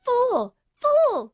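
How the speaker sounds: high shrieking yell of fool. A narrator's reading. Wife shrieking fool at her husband. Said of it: "she yelled".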